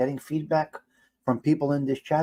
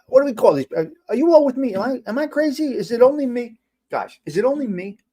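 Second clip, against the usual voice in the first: high-pitched, urgent appeal; exasperated disbelief; 9 semitones higher, 4 dB louder than usual. Real, not acted. Streamer asking viewers if they agree, exasperated.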